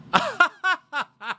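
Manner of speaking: very high posh titter; hammed-up snooty giggle; a pretend millionaire's laugh; fake rich laugh. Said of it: "what was that laugh at the end".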